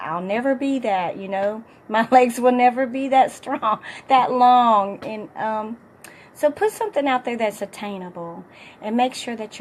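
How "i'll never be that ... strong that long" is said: laughing through the words, much louder toward the end; laughing self-mockery. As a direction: laugh it off while admitting it. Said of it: "I'm like".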